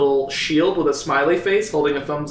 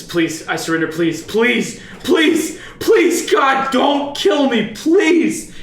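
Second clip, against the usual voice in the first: high, desperate pleading for life; terrified begging; 7 semitones higher, slower than usual. Acted. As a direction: beg for your life, voice cracking high. GM voices begging captured enemy.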